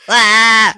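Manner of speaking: high, loud cartoon scream; one full-out cartoon "ah"; SpongeBob yell. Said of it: "I'm yelling at Patrick".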